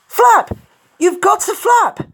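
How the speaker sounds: very high, loud yell of instruction; frantic coaching yell; urgent shouting. A narrator's reading. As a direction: shout it out urgently, pitched right up. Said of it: "Dash shouted out".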